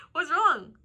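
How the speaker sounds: usual speaking voice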